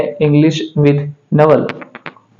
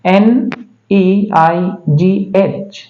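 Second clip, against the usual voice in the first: slow letter-by-letter spelling; ई आई जी एच said letter by letter, slowly; spelled out slowly; much slower than usual. Shown as a demonstration. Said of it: "उसी तरह से".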